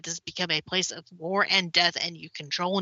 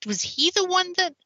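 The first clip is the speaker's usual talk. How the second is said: quoting herself; 11 semitones higher than usual. Retold or imitated. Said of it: "and I'm like".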